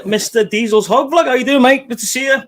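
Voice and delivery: high, loud announcer welcome; hype greeting. Real, not acted. Host introducing the second guest, loud and excited. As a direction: bellow the guest's name in with glee.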